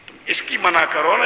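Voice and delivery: ordinary delivery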